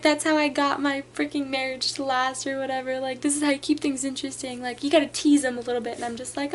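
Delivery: ordinary talk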